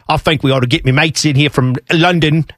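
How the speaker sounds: hammed-up Cockney-ish English accent; lads-from-London bad English accent. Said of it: "How many bad English accents".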